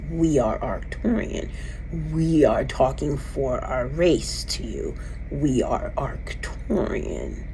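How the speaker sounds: slow, even whispered reply; alien whisper. Retold or imitated. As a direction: hush it out like a voice in your head. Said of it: "exactly the cadence that it said it".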